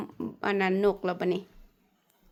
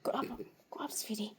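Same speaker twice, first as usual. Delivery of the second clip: soft whisper to a pet; whispering over a crib; 20 dB quieter than usual. Real, not acted. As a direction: breathe it in a hush. Whisper to dog at bedtime.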